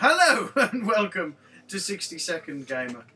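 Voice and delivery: loud, high, slow pirate voice breaking into laughs; mock pirate welcome, laughing; laughing pirate intro. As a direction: give up on the pirate but keep hamming it. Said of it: "terrible pirate accent".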